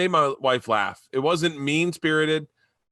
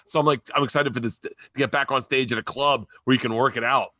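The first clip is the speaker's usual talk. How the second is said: ordinary talk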